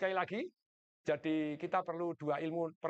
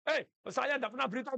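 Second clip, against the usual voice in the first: indignant retort; 6 semitones higher than usual. Retold or imitated. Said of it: "Saya bilang".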